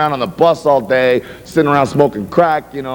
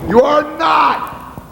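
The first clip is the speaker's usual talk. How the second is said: high drawn-out protest; 13 semitones higher, much slower than usual. Acted. Bandmate contradicting a boast.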